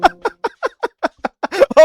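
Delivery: high-pitched real laughing fit; helpless laughter. Real, not acted. Streamer amused by the guests' insults, helpless laughter. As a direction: crack up, high and drawn out.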